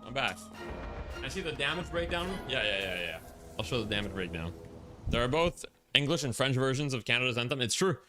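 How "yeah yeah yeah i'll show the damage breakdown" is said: usual speaking voice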